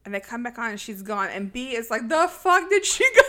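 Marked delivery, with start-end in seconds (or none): 2.1-3.2 high, loud incredulous outburst; mock outraged bafflement. Retelling B finding her gone, loud mock bafflement. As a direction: yell it in comic disbelief.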